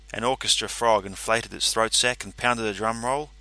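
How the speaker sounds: ordinary delivery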